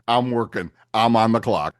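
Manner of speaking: ordinary talk, nothing marked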